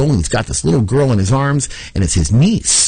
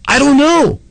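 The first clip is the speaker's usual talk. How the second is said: panicked neighbor; 3 dB louder than usual. Retold or imitated. Acted exchange with neighbor Eric.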